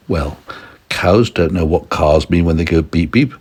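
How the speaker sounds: usual speaking voice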